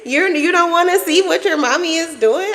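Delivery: high-pitched teasing disbelief; playfully wounded, voice lifted high; mock indignant. Mother wanting her son's attention, mock indignant.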